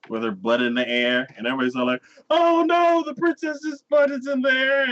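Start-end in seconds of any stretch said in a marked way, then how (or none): 2.3-4.8 high, loud scandalized wail; hammed-up horror; mock crowd panic. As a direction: cry out like a shocked crowd. Shocked crowd, acted.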